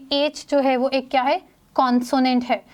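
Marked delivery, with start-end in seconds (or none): none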